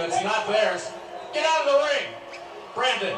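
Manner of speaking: very high, very loud yell; furious yell. Real, not acted. Interviewer yelling at Porter to leave the ring. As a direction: scream at him to get out.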